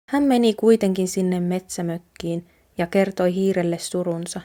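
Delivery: usual speaking voice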